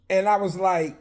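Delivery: ordinary talk, nothing marked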